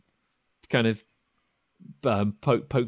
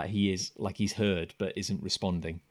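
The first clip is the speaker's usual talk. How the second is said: normal talking voice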